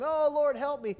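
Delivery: high, loud plea of a sinking man; desperate cry for help. Retold or imitated. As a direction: call out to be saved. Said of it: "who did he cry out to".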